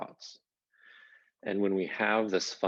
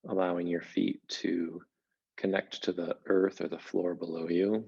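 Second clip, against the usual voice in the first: guided meditation. Acted. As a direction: gently guide listeners into settling, leaving space between phrases. Begins leading a guided meditation.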